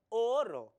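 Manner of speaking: loud, drawn-out "oro" with a long first o; "oro" said as a heavy "o-oro"; doubled o. Shown as a demonstration. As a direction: stretch the first o of "oro" as if two vowels collide. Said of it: "se estaría feo la pronunciación".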